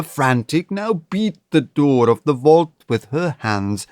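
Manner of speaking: ordinary delivery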